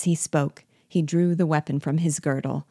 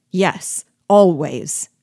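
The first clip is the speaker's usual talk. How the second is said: louder burst on "always"; furious retort; 2 semitones higher, 8 dB louder, much slower than usual. A narrator's reading. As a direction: throw it back angrily, punching "always". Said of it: "returned I, furiously".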